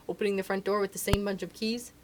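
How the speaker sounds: usual speaking voice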